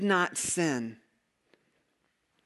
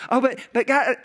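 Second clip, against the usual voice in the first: higher, louder mock worried plea; fretful believer begging God; hammed-up anxious question; anxious pleading; 5 semitones higher, 5 dB louder than usual. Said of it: "anxious believer questioning God".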